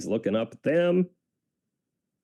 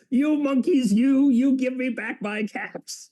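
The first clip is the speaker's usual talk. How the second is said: higher, louder, slow angry demand; angry peddler; 12 semitones higher, 3 dB louder than usual. Acted. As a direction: shake both fists and demand them back. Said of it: "This made the peddler angry".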